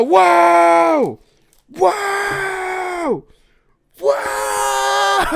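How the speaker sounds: long high shouted whoas, almost crying; overwhelmed gasping shouts of wonder; real disbelief pouring out; astonished whoa. Real, not acted. Unboxing a gift, astonished.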